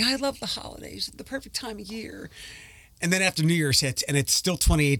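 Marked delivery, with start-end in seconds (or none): 0.0-2.3 high, soft sarcastic gushing; mock the holiday lovers sweetly. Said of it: "why people disgust me".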